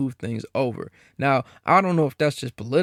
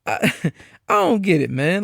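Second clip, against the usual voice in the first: a laugh breaking into the words; laughing in disbelief; disbelieving laugh; 3 semitones higher than usual. Real, not acted. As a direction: laugh it off, stunned.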